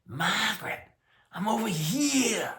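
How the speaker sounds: put-on high, loud, harsh holler in a Maine accent; screamed Maine accent. Huge Maine man hollering to his wife, harsh screamed accent. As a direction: imitate a Mainer bellowing across a parking lot. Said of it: "he screams at his wife in his Mainish accent".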